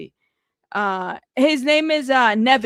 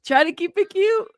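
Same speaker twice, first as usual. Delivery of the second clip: sing-song high; 8 semitones higher, 3 dB louder than usual. Shown as a demonstration. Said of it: "I'm trying".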